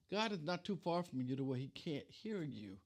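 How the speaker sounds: normal talking voice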